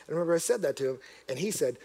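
ordinary talk, nothing marked

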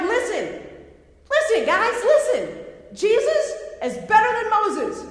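excited preacher voicing an urgent writer; urgent exhortation. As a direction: call for the crowd's attention, pitched high and loud. Acting out Hebrews author urging readers.

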